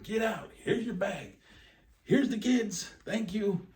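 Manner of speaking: high, loud hammed-up rush, laughing; mock hurried shout. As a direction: shove the kids out and yell it fast. Caseworker dumping kids fast.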